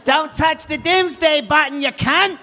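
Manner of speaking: Aussie villain shouting threats; megalomaniac rant. Acted. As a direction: yell it in a mock Australian accent. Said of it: "you're not really sounding like a supervillain".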